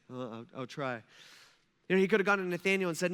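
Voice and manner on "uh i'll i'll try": "Uh, I'll, I'll try" with faltering repeats, soft and low; mimicking how we all dodge with a hesitant "I'll try"; hesitant stammer. Shown as a demonstration. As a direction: stumble into a reluctant "I'll try". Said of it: "isn't that what we say?".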